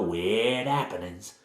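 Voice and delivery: slow Cockney, dropping the H in "happenings"; bad Cockney accent. Village woman warning strangers about the house, in bad Cockney. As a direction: draw out "weird happenings" in hammy Cockney. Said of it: "she has a sort of bad Cockney accent".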